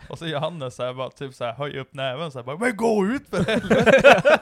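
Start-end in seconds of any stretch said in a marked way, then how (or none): 2.6-4.4 loud, high, drawn-out mock yell; furious shout. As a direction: bellow the retold order slowly. Retold Johannes yelling with raised fist.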